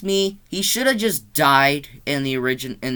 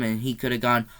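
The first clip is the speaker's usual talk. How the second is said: normal talking voice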